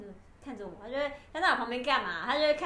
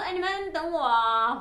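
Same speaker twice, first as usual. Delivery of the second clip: raised, drawn-out call to friends; 6 semitones higher, 7 dB louder, slower than usual. Retold or imitated. Student asking friends to wait for her, calling out. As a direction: re-enact calling after them, louder and slower.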